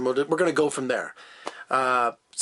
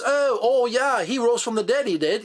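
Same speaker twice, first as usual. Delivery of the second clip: mock English accent, pitched up; 6 semitones higher than usual. Reviewer mocking the disciples' way of talking, English accent. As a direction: put on an exaggerated English accent, higher than usual. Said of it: "all talking like this".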